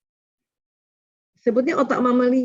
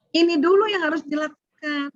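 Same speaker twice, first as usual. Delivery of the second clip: ordinary talk, nothing marked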